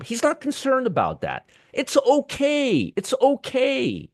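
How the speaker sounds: high, soft, slowly drawn-out "okay"; sarcastically soothe, stretching it out in a lifted voice; mock reassurance. Host mocks the secretary's unconcern over trillions lost, sarcastic reassurance.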